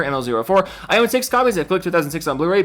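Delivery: ordinary delivery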